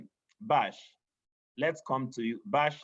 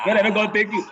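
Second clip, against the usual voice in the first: laughing; 5 semitones higher, 6 dB louder, much faster than usual. Real, not acted. Laughing reply in pidgin.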